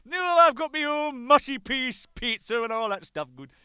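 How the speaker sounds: high, loud mock Yorkshire boast; hammed-up boasting manager. Manager bragging to the band about his corner of the rainbow. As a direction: brag in a put-on northern voice. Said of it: "he said".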